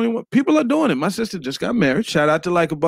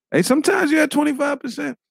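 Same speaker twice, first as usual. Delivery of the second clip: shout; 9 semitones higher than usual. Real, not acted. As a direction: belt it out to the room. Calling out loudly.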